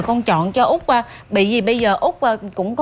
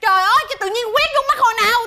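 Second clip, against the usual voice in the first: high, fast indignant denial; indignant protest; 8 semitones higher, faster than usual. Acted. Manicurist accused of painting someone's eye, indignantly protesting. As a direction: protest innocence in a rush, pitched up.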